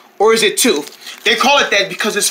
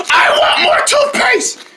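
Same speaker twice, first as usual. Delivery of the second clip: whiny crying demand; 10 semitones higher than usual. Acted. Refused more toothpaste, wailing a tantrum. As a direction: wail like a spoiled kid.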